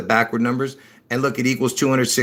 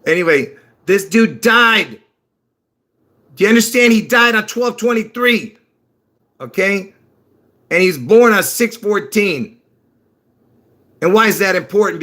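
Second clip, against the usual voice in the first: much higher and louder, insistent; excited outburst; 9 semitones higher, 5 dB louder than usual. Real, not acted. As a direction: hammer the dates home, worked up. Emphatic point about death dates.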